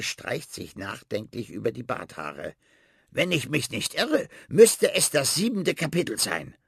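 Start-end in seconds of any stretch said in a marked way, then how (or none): 3.1-6.6 rat voice. Acted. Rat recalling the chapter.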